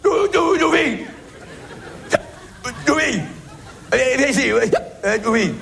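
appel aigu au chien, entre deux rires; continue l'imitation d'Alain, voix haute; imitation d'Alain. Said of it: "j'adore l'imiter". (imitation d'Alain qui rappelle Toufik, aigu)

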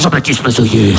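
deep, low announced name; grave announcer reveal; trailer name reveal. Acted. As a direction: drop it very low like a film credit. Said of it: "comme si je suis dans un film de flic".

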